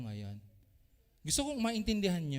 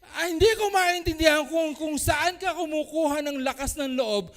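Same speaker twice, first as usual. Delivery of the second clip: 1 indignant rant; 7 semitones higher, 7 dB louder than usual. Retold or imitated. Acted angry reply.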